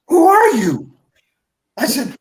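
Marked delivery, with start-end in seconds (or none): 0.1-0.9 louder, higher imitated interrogation; raised-voice retold confrontation; demanding challenge. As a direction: demand an answer like a suspicious boss. Director confronts him about his identity.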